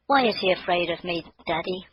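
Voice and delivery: put-on high child's voice, puzzled; a girl asking why the deer fears her; puzzled little girl.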